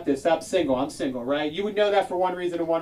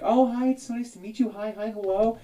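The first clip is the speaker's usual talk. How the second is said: lightly voiced sugary LA greeting, higher; a hint of fake LA niceness; fake-nice Angeleno; 6 semitones higher than usual. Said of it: "they're gonna be like".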